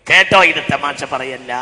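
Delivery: projected, intoned sermon warning; loud preaching call. Shown as a demonstration. Preacher warning listeners this is no joke, loud intoned call. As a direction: call it out with a stretched ending.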